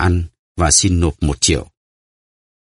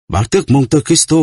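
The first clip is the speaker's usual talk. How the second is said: a high, louder cry of recognition; shocked cry; 8 semitones higher, 3 dB louder than usual. A narrator's reading. Prisoner recognising his captor, crying out in shock. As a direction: gasp the name out in sudden shock. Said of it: "Đăng La kêu lên".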